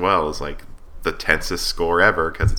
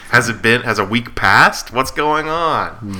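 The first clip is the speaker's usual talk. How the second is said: higher, louder drawn-out questions; mock bewilderment; 6 semitones higher, 5 dB louder than usual. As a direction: act out a baffled viewer, voice climbing. Acting out a confused viewer.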